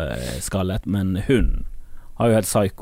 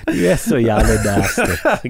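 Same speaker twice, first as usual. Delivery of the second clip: laughing; slower than usual. Real, not acted. Laughing at crude joke.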